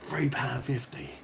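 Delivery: slow, breathy whispered price in disbelief; hushed, appalled repeat of the price; disbelieving whisper. Real, not acted. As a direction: whisper it like you can't believe it.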